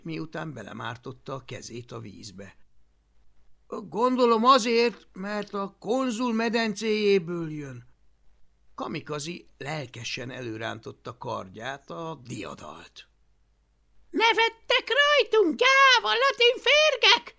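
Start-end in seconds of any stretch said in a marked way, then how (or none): 3.7-7.9 character voice. A narrator's reading. Character reply in story. 14.1-17.4 high, loud battle cry; furious shout. A narrator's reading. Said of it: "kiáltotta".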